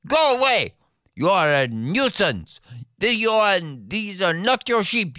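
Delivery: high, fast, indignant scolding; a high-pitched hero scolding a dragon; Stan scolding. Acted.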